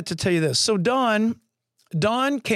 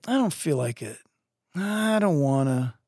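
bummed; 2 semitones lower than usual. Retold or imitated. Bummed friend refusing to come along, mock-glum reluctance. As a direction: drag it out, playing a mopey friend. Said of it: "he'd get bummed".

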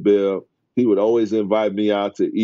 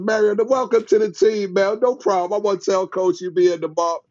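lightly voiced higher Barkley; casual higher impression of Barkley; Charles Barkley voice; 11 semitones higher than usual. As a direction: toss it off casually as the star. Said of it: "He said".

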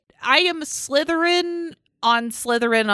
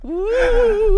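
ghostly woo; 11 semitones higher, 5 dB louder than usual. Pretend. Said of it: "We have a ghost guest".

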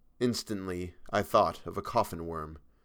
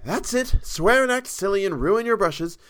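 much higher, louder scolding; 10 semitones higher, 4 dB louder than usual. A narrator's reading. Model scolds painter for ruining brushes, higher louder scolding. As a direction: tell him off sharply, higher and louder. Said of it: "she promptly began".

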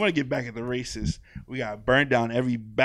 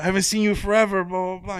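excited greeting; 8 semitones higher, 5 dB louder than usual. Retold or imitated. Retold greeting of long-lost friend.

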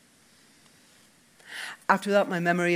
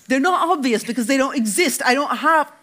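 furious protest; 10 semitones higher, 9 dB louder than usual. Acted. Dec furious denial.

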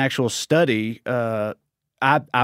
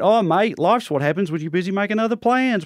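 higher-pitched put-on Australian accent; 7 semitones higher than usual. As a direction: play a matey Australian. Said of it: "Australian accent".

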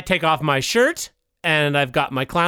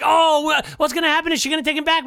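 eager audience voice; 10 semitones higher than usual. Retold or imitated. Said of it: "the audience is like".

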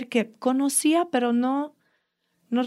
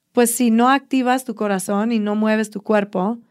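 usual speaking voice

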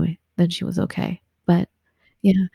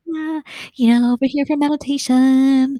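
put-on higher, breezy Southern California accent; 5 semitones higher than usual. Mocking how she talked on arrival in England, Southern California accent. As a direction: lay on the laid-back Southern California accent. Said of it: "So I would talk like this".

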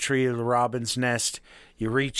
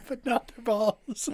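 laughing; 10 semitones higher, slower than usual. Real, not acted. Laughing aside.

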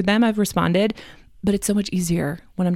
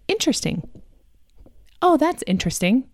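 modeling polite curiosity, lightly raised pitch; polite interest; 6 semitones higher than usual. Shown as a demonstration. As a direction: show a child how to react politely. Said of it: "that is much more polite".

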